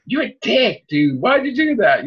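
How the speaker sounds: high, incredulous telling-off; imagined scolding. Retold or imitated. Fan imagining confronting the writer, high incredulous scolding. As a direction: scold him like a friend who let you down. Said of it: "But, like".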